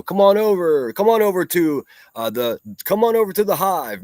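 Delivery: high, loud sarcastic invitation; mockingly beckon, pitched well up; mock welcome. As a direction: call them over like a hammed-up host. Mocking invitation to a rival's channel.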